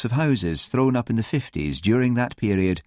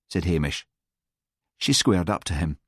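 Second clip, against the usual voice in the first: ordinary delivery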